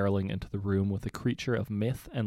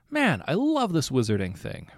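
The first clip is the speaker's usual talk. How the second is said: put-on thrilled boy; 6 semitones higher, 6 dB louder than usual. A narrator's reading. Jason thrilled.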